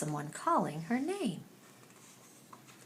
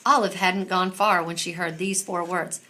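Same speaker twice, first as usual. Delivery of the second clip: plain reading voice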